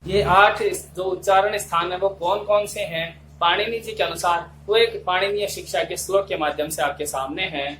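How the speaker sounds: ordinary delivery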